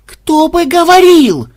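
high, loud, slow outraged cry; indignant. Acted. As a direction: shout back in outrage. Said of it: "возмутился программист".

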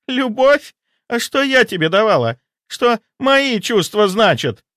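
very high, indignant outburst; hysterical. A narrator's reading. Hysterical mistress demanding what her feelings mean, very high and indignant. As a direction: cry out in hysterical disbelief.

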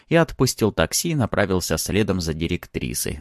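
usual speaking voice

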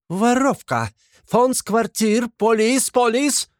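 very high landlady's wail; shrieking. A narrator's reading. Landlady Amalia calling police on the thief, shrieking. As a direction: yelp it out high in broken Russian. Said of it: "завопила Амалия Ивановна".

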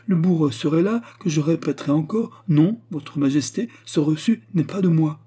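impassioned protest, high and loud; vehement denial. A narrator's reading. (dénégation véhémente, voix haute et forte)

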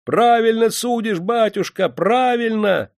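high, drawn-out cries from a crowd; crowd shouting approval. A narrator's reading. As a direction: cheer on the judge in a high, stretched call. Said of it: "заглушили крики". (одобрительные крики толпы)

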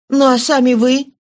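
angry exclamation. A narrator's reading. Marius cries out at Valjean in angry reverence. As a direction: cry out at him, indignant. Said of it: "вскричал Мариус с гневом".

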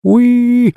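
very high excited squeal; happy baby squeal. Acted. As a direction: squeal like a delighted toddler.

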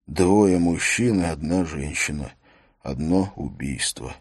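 plain reading voice

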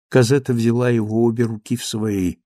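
ordinary delivery, nothing marked

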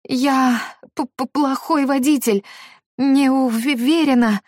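high, shaky stammering panic; panicked stammer. Acted. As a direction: stumble over the words in fear.

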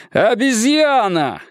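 high, slow, drawn-out insult yell; shouting. Acted. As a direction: yell it at the other driver. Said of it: "крикнул бывший прапорщик". (громкий протяжный крик)

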